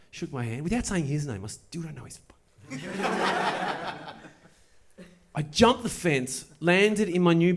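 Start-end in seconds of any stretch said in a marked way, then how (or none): 2.9-4.1 a soft, helpless laugh at the memory; breathy laugh. Real, not acted. Telling the story, a soft helpless laugh. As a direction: laugh quietly at how absurd it was.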